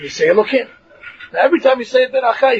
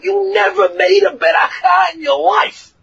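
raised, accusing voice up high; heavenly accuser; 9 semitones higher than usual. Retold or imitated. Heavenly court rebuking a man after death, accusing shout. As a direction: call him out from on high. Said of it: "they're gonna say".

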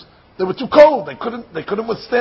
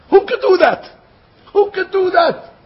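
high-pitched, amazed rhetorical question; incredulous exclamation; 9 semitones higher, 3 dB louder than usual. Acted. Yosef to his brothers on God's doing, incredulous.

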